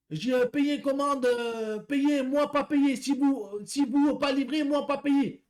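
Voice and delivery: high, very slow broken French with a put-on foreign accent; mock foreign accent. Driver mimics the customer refusing to pay, slow mock foreign accent. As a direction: drag out her protest in a foreign accent. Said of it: "je vous fais l'accent".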